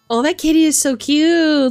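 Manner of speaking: normal talking voice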